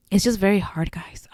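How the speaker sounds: whispering, choked up; real tearful whisper to friends; emotional whisper. Real, not acted. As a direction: let the feeling break the voice into a whisper. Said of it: "I'm gonna get emotional".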